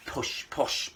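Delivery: "push" then "posh", vowel fixed; correcting push to posh. Shown as a demonstration. Comic correcting the puppet's "push" to "posh". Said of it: "posh, I said".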